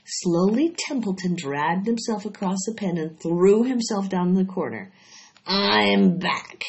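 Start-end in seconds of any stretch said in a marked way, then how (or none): 5.4-6.6 husky, louder growl of a tired rat. A narrator's reading. Rat back from a feast, husky. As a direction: rasp it out like a stuffed rat. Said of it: "he said in a husky voice".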